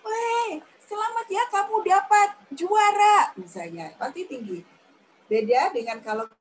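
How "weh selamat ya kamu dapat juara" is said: much higher, louder congratulations; bright cheer for good news; happy high tone. Shown as a demonstration. Teacher demonstrating how to share happy news. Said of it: "nada suara kita tinggi ya".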